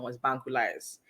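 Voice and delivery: usual speaking voice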